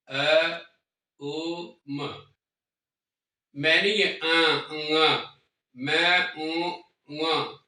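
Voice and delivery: isolated vowels अ, ओ, म held and drawn out; syllables of ओंकार demonstrated; sound demo. Shown as a demonstration. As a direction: show each syllable slowly, one at a time. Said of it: "तीन शब्द ऐसे हैं".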